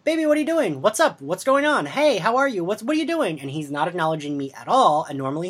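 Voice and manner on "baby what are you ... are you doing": put-on high, sweet coaxing to a small child; sing-song worried aunt. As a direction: gently call the little one. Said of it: "I am, like, immediately like".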